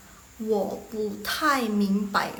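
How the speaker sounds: slow Mandarin with separated syllables; slow model reading. Shown as a demonstration. Teacher introduces phrase number three, slow model reading. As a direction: sound it out carefully for learners. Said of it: "Next yang nomor tiga".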